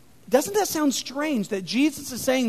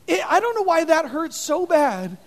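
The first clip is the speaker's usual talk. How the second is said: normal talking voice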